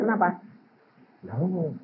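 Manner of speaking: ordinary talk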